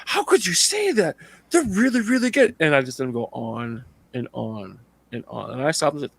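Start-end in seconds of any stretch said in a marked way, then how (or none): 0.1-2.6 high, indignant protest; a friend outraged you'd knock his movie, pitched up; a friend's pitched-up, offended disbelief. Retold or imitated. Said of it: "He goes".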